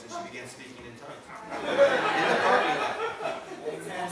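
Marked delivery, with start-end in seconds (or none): 1.5-4.1 breaks into high airy laughter mid-phrase; laughing. Real, not acted. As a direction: laugh the words out in delight. Laughing retelling of the miracle.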